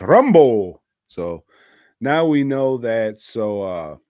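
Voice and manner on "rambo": Rambo in a mock Mexican accent, loud with a pitch swoop. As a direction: punch the name with a Mexican accent. Mexican-name joke on the title.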